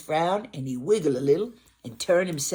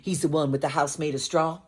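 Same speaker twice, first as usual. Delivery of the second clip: usual speaking voice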